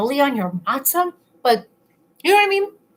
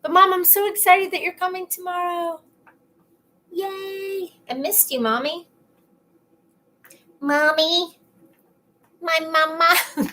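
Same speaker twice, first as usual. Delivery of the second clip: put-on like a child; 7 semitones higher than usual. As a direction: play an adoring small child missing mommy. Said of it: "Like a child".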